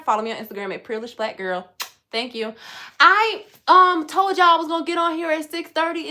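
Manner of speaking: ordinary talk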